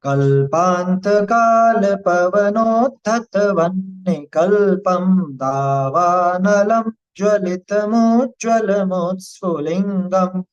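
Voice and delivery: slow chanted Sanskrit shloka, each syllable drawn out; slow verse recitation. Shown as a demonstration. Teacher chanting the shloka for the class, slow and measured. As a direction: intone the verse slowly and reverently.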